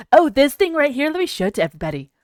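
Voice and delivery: loud, hammed-up showy presenter; lightly voiced mocking friend; mock Pyro. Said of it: "He's like".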